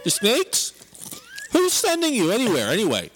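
Uncle Larry voice. Acted. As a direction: grill him as Uncle Larry. Uncle Larry character interrogating.

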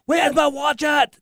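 hammed-up British; mock British accent. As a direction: stretch it out like a fussy Brit. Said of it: "little British accent".

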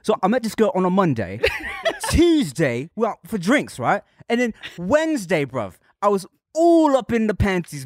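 hammed-up British lad accent; British accent, punching key words high; put-on British accent. Podcaster mocking a love song's lyrics, British accent. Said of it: "It's not okay to do accents anymore".

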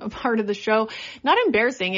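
ordinary talk

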